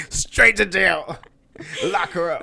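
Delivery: loud, drawn-out, high mock verdict; hammed-up cry of outrage; mock judge's sentence. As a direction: call it out like a joking judge. Joking mock sentence after dad joke.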